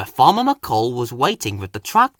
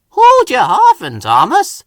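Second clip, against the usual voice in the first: higher and louder, a big friendly grin in the voice; smiling; 10 semitones higher, 9 dB louder than usual. A narrator's reading. Hank cheerfully teases huffing Thomas, smiling. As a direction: tease him kindly with a smile. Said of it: "smiled Hank".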